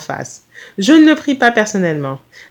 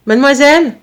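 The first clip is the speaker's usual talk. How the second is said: loud, high-pitched call; brisk, raised hail; 7 semitones higher, 5 dB louder than usual. Acted. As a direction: call out to catch a stranger's attention. Mother hails a stranger.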